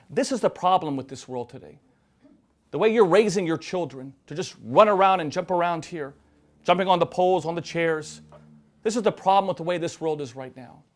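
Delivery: mock telling-off with punched words; frustrated. Frustrated lady scolding a father on the subway, frustrated telling-off. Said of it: "gets so frustrated".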